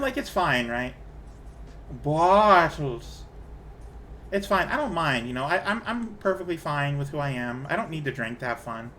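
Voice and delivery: normal talking voice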